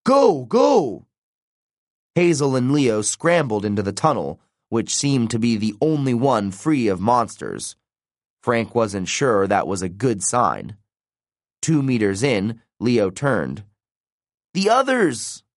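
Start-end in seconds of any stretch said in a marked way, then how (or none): 0.0-1.1 high-pitched, urgent repeated shout; yell. A narrator's reading. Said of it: "Frank yelled". 14.6-15.4 high-pitched cry of alarm. A narrator's reading. Alarm for friends left behind.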